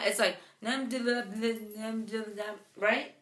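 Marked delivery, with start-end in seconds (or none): 0.6-2.4 put-on soft, rambling baby babble; mimicking an infant's babbling; imitated baby babble. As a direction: babble nonsense quietly like a baby. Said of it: "he just babbles".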